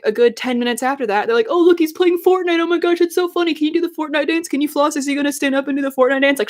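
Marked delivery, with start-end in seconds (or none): 1.5-6.4 put-on much higher, excited kid voice, speeding up at the end; mocking little boys. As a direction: imitate hyped eight-year-olds at the movies. Imitating the eight-year-old boys.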